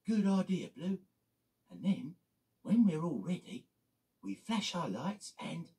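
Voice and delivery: chirpy little car plotting mischief; high Mini voice. Acted. Blue plots a prank with the other Minis, high voice.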